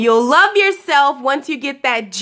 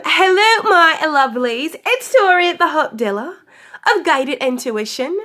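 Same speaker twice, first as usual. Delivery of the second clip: a hammed-up, raised-pitch London accent; a playful, bad London accent, pitched up on hello; mock London accent; 3 semitones higher than usual. Vlogger greeting her love muffins in a jokey London accent. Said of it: "doing a horrible job at an England accent".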